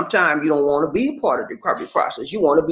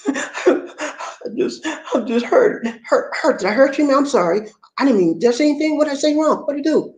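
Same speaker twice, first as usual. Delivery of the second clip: high mock-tearful voice; 10 semitones higher than usual. Crying girlfriend then worried man.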